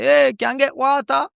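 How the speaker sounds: high, Jamaican-leaning "Hey, can I get water?"; a tourist trying on the local lilt; tourist's Jamaican attempt. Tourist ordering in a shop after two weeks, mock Jamaican lilt. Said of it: "them change a little bit".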